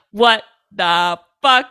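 slow, word-by-word incredulity; slow, emphatic bafflement; bewildered disbelief. Retold or imitated. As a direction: space out every word in disbelief. Speaker's bewildered reaction, spaced out.

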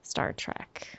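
soft Minnesota Star Trek; Minnesota accent. Shown as a demonstration. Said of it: "It really is my accent".

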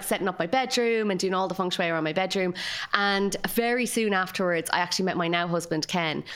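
ordinary talk

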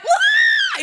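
sudden very high shocked yelp; overwhelmed cry at a sunflower word; high squealing yelp. Retold or imitated. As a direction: let out a loud squeal of disbelief. Her stunned reaction to prophecy.

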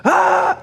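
sharp high mock scream; imitated scream. EMT retelling patient's scream, short high mock scream. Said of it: "the woman is screaming her head off".

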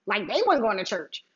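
ordinary talk

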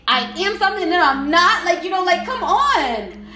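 heated, insistent demand to be left alone; fed-up pleading. Real, not acted. Telling a toxic person to leave them alone, fed up. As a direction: plead angrily, hammering each repeated demand.

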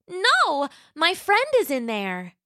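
put-on high, sweet little dinosaur girl voice; Roxy the young T-Rex. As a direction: play a polite, eager young T-Rex. Roxy forbids.

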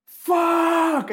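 imitated furious shout of Johnny; mock shout. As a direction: yell "Fuck" in retold anger. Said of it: "Johnny gritando".

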